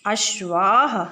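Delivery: अश्वाः drawn out with long final aa; slow correct answer. Shown as a demonstration. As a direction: give अश्वाः slowly as the right form. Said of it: "तो कौन सा होगा?".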